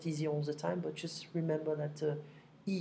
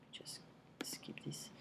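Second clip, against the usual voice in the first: 1 whispered aside; 15 dB quieter, slower than usual. Real, not acted. Aside muttered off to self.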